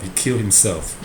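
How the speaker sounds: ordinary talk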